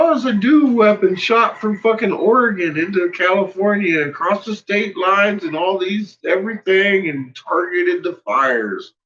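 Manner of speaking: high, mocking voice for a rival conspiracy guy; high sneering send-up of the other guy's claims; mocking Dutch Sense. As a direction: ham up the rival's nonsense, way up high. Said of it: "Dutch Sense out there talking about".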